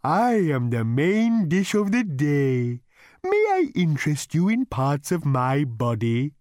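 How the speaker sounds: high, slow, courteous cow; polite cow voice. A narrator's reading. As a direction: offer yourself like a cow waiter. The animal speaks.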